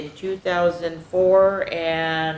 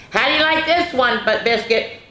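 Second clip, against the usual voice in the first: a bit higher, cheerful call to the puppy; lightly voiced owner; 6 semitones higher, 4 dB louder than usual. Owner calling Biscuit, cheerfully a bit higher. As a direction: call the puppy along, brightly. Voicing story character.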